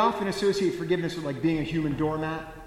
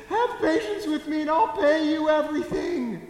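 high, loud, desperate plea from a slave on his knees; desperate begging; 11 semitones higher, 6 dB louder than usual. Acted. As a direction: cry out for mercy, much higher and louder. Slave begs king on his knees.

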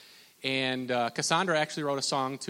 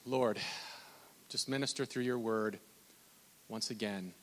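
soft, low prayer with pauses; hushed appeal to God; 4 semitones lower, 8 dB quieter than usual. Real, not acted. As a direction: pray quietly and unhurriedly. Opens prayer.

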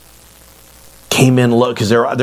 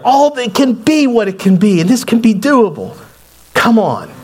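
high, urgent appeal; impassioned pleading; 9 semitones higher than usual. Retold or imitated. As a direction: beg for it to be possible. Retold plea for the property.

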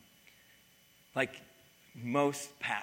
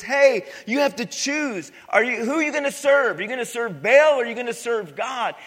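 prophet's challenge; 9 semitones higher, 12 dB louder than usual. Retold or imitated. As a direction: thunder the challenge at the people. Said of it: "and he says".

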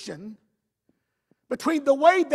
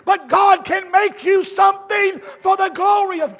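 bold declaration; 6 semitones higher, 8 dB louder than usual. Retold or imitated. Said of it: "a boldness and a confidence that says".

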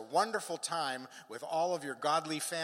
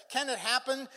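high, searching question; acted pleading; 12 semitones higher, 7 dB louder than usual. Believer asking the Father for hope, high pleading. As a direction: ask it pleadingly, pitched up.

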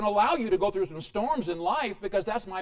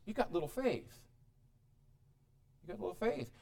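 soft rebuke; 9 dB quieter than usual. Acted. Jesus rebuking gently.

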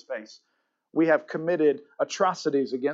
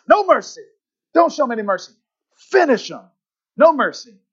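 high, loud, punched-out commands; forceful movie-villain shouting; Cobra Kai battle cry; 8 semitones higher, 7 dB louder than usual. Acted. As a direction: shout short orders like a merciless karate sensei. Voicing the Cobra Kai message.

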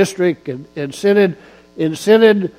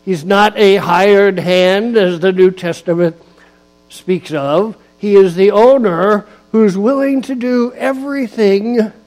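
louder emphatic preaching with punched words; 3 semitones higher, 4 dB louder than usual. Acted. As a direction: drive each phrase home to the pews. Preaches on Psalm 23.

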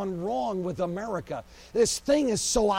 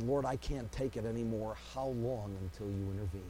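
weary plea; 9 semitones lower, 9 dB quieter than usual. Acted. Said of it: "they said".

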